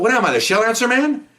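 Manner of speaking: high, indignant rhetorical jab; gruff sarcasm. Retold or imitated. Gruff cop brushes off a man wanting directions, mock indignant. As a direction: imitate a put-upon gruff cop. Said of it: "he's really gruff".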